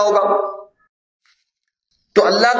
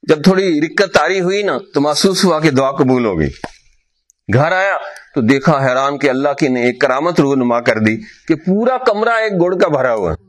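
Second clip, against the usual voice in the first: ordinary talk, nothing marked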